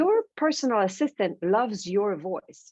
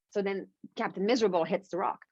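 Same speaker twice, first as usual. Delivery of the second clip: usual speaking voice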